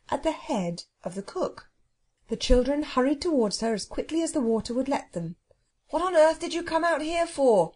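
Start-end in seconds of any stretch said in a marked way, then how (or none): 5.9-7.7 high, raised-voice demand; exasperated shout. Acted. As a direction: shout the question across the water. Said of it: "Robert shouted".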